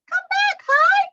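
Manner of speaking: very high squeaky call. Shown as a demonstration. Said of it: "the other named High".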